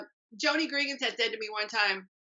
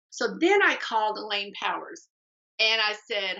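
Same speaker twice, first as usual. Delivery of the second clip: usual speaking voice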